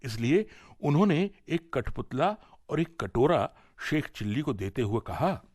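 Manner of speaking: ordinary delivery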